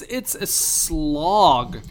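very slow, stretched-out SLOG; dragging it out. Real, not acted. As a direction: make "slog" sound as tedious as it is. Drags out word to show tedium.

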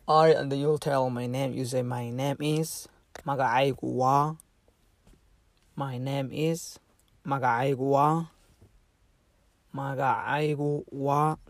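ordinary talk

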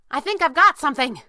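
high, loud call of discovery; excited call. A narrator's reading. Kit calling from across the room, excited. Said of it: "Kit called excitedly".

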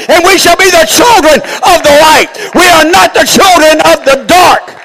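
loud, high, driving sermon shout; full-force preaching. Real, not acted. Preacher urging the congregation to find the light, full-force preaching.

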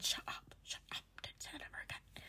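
very soft, unvoiced whisper; barely audible mock scolding in a whisper; retold whisper. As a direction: whisper it, imitating a friend hushing you. Friend's reply to self-criticism, retold.

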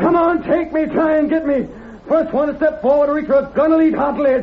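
high-pitched cornered defiance; defiant angry shout. Acted. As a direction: hurl the challenge at them. Cornered man defies the posse.

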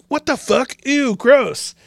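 high, disgusted squeal. Retold or imitated. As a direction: recoil in disgust. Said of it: "everyone was like".

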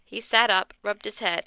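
usual speaking voice

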